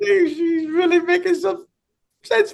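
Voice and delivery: high, slow words through laughter; giggling while talking; real laughter breaking through; laughing. Real, not acted. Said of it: "I'm laughing".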